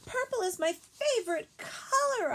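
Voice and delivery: high, loud shout across the room; child yelling to cheer up a classmate; yelled. A narrator's reading. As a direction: call it out loud and high, hoping to cheer her. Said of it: "I yelled".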